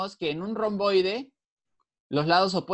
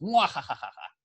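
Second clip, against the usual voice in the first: evil villain cackle; mustache twirling villain. Pretend. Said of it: "Me voy a volver malo".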